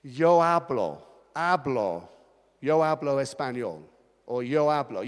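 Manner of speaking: "Yo hablo" in Spanish, H silent. Shown as a demonstration. Pastor showing the congregation how Spanish drops the H. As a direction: say "hablo" as "ablo". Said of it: "you say".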